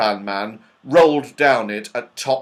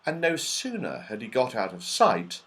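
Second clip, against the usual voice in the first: usual speaking voice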